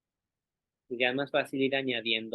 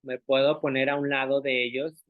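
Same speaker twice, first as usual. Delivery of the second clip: ordinary talk, nothing marked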